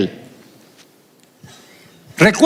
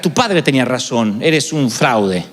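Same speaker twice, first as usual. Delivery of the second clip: mock accusing voice; 9 semitones lower than usual. Feared accusation voiced in sermon.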